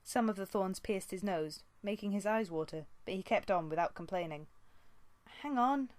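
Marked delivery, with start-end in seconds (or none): 5.4-5.9 put-on a higher young she-cat voice; a strained apprentice tugging free; Squirrelpaw, lightly voiced. A narrator's reading. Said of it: "Squirrelpaw muttered".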